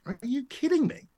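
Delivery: high, astonished squeak of disbelief; can't believe the offer, pitch jumps up; retelling his surprised reply to the editor. Retold or imitated. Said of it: "And I was like".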